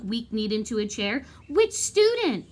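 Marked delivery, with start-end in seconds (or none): 1.5-2.5 much higher, different voice; desperate for the name; distressed teacher. Acted. Distressed staff asks.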